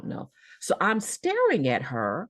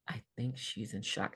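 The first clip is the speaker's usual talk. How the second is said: muttered aside; 5 semitones lower, 11 dB quieter than usual. Retold or imitated. Passersby's imagined thought.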